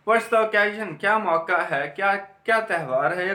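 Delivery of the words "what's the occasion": ordinary talk, nothing marked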